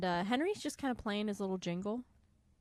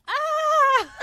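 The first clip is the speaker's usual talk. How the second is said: mock hero yell; 16 dB louder than usual. Otto's cry as he charges.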